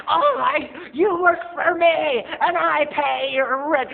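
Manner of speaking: high, loud, slow sass; diva mom. Retold or imitated. Mom scolding clerk, high loud slow sass. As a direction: lay down the law like a diva. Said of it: "She was like".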